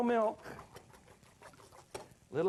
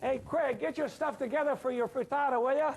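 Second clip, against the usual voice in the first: calling out; 8 semitones higher, 3 dB louder than usual. Real, not acted. Calling to helper offstage.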